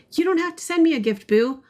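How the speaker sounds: high, fast and sweet; lightly sweet high voice; playful high voice. Acted. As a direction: tease back in a high voice. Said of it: "You're too good at that".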